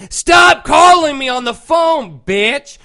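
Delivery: high, loud, drawn-out yell; acted-out outburst at a pestering caller; retold angry shout. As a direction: yell it slowly down the phone in fury.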